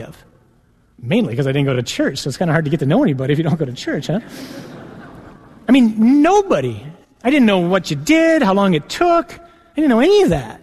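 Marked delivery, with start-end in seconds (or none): 5.7-10.6 emphatic disbelief. Real, not acted. Insisting he knew nothing.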